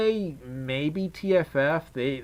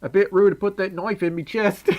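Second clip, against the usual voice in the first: higher-pitched, hammed-up British lad; mock British accent; 6 semitones higher than usual. Streamer jokes about British people, mock British accent. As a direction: put on a cartoonish British accent. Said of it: "there's not one singular British accent".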